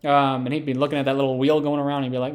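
ordinary talk

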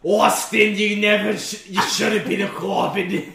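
loud, hammed-up scolding impression; performed loud rant. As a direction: berate Austin in mock outrage. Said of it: "I have to do this".

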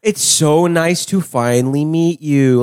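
slow, over-careful politeness; exaggerated patient slowness; talking to the elderly. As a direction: draw it out for an elderly listener. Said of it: "I've met elderly people as well".

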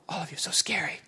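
breathy teasing whisper to the crowd; mock whisper. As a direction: whisper it like they're truly frightening.

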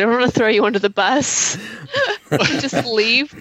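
words breaking into a high laugh; sheepish laughing admission. Real, not acted. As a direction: laugh through the line, half giggling. Player laughs while explaining choice.

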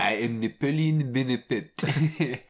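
pompous fake European accent; mock accent Napoleon. Co-host jokingly voices Napoleon in a hammed-up accent. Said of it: "there was a lot of accent work in House of Gucci".

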